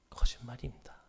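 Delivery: low whispered verdict; hushed, dropped whisper. Acted. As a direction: whisper the word like a secret.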